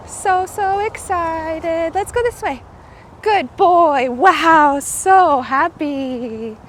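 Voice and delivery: loud, slow gushing pet talk; doggy praise. Real, not acted. Owner praising her excited dog on the walk, loud and slow.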